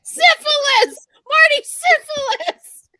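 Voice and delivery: high, loud gleeful shout; excited squeal. Real, not acted. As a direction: squeal the word with delight. Excited shouting of a gross fact.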